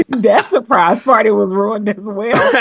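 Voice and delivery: laughing through the words. Real, not acted. To her brother, laughing about the ruined surprise party. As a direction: say it while laughing at the memory.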